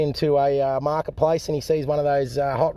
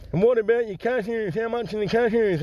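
high-pitched bloke with a cleft palate; 7 semitones higher than usual. Acted. Joke teller voicing the customer's greeting, cleft palate voice. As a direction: put on a higher cleft-lip speech impediment. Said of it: "This bloke got a cleft palate and a hair lip".

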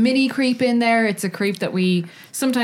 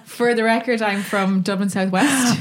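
ordinary talk, nothing marked

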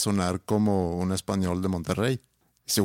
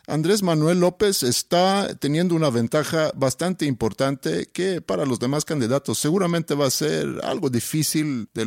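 higher, polished Mexico City pundit voice; formal chilango accent; 4 semitones higher than usual. Pretend. Doing a formal intellectual chilango on request, pundit tone. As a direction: read it like a TV political analyst from the capital. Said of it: "un poquito más formal".

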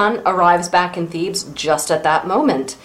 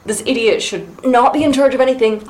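usual speaking voice

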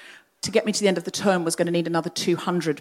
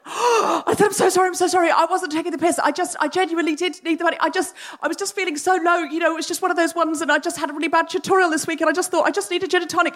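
much higher, fast, embarrassed gushing; flustered apology; 8 semitones higher, faster than usual. Retold or imitated. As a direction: babble sorry in a high panic.

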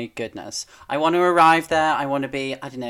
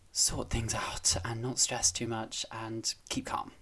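whisper; 2 semitones lower, 12 dB quieter than usual. Real, not acted. Calming advice, whispered.

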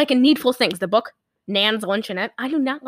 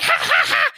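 imitated high-pitched girlish laugh; mock peal of laughter, pitched way up; girl's laughter; 4 dB louder than usual. Imitating a girl laughing in the woods, high ha-ha-ha. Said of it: "you just hear a girl laughing".

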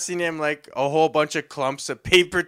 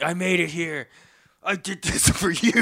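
higher, a proud devoted buddy; a bloodied friend proudly telling him he came, mock earnest; 5 semitones higher than usual. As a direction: sell the sacrifice with a grin.

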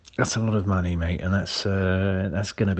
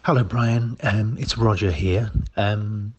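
ordinary talk

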